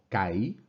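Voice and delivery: kai as one syllable, KA-i, weight on the A; stress on the A. Shown as a demonstration. Teacher showing diphthong pronunciation, stress on the A. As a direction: lean on the A of kai and let the I glide off. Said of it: "vamos a pronunciarlo sobre la A".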